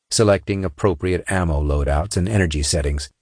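ordinary delivery

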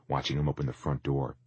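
usual speaking voice

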